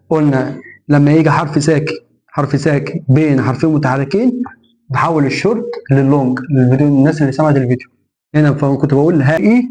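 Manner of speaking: ordinary talk, nothing marked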